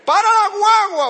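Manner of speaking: high, loud shout; imitated child shouting in his sleep; sleep-talking shout. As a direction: yell it like a boy dreaming on a bus. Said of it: "el otro es".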